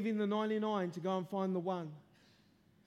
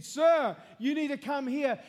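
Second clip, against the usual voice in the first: calling out; 5 semitones higher, 7 dB louder than usual. Retold or imitated. Preacher calls him out mid-sermon.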